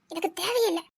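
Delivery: tearful, choked-up words; crying at the wedding photo. Acted. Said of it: "டேய் தாஸ், அழாதடா".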